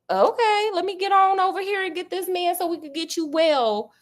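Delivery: high voice, quick to agree; high eager Watson. Retold or imitated. Watson answers sick Sherlock, high and eager.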